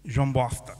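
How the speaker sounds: low "Bosta" with a slushy s; low, thick-tongued "João Bosta"; lisped speech. Acted. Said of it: "se língua fala um bocadinho xuxa".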